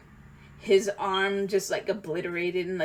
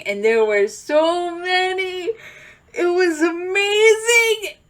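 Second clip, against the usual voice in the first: high, slow, choked-up gush; overjoyed; 9 semitones higher, 6 dB louder, slower than usual. Real, not acted. Fan gushing to viewers about the Endgame battle, high and teary. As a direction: voice lifting with emotion, near tears.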